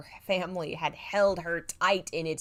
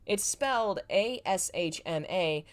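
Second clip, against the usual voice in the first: plain reading voice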